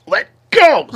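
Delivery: high, loud strained cry; exasperated yell. Retold or imitated. Driver fighting a bottle stuck in the cup holder, exasperated yell.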